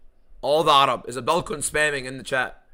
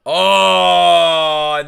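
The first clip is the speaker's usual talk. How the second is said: pained groan; 3 semitones higher, 10 dB louder than usual. Real, not acted. Reaction to nut shot.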